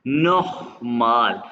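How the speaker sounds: नोखमाल drawn out slowly with a throaty ख; slow नोखमाल with a rasped ख; guttural kh. Shown as a demonstration. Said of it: "आपने खे की आवाज़ निकालना है".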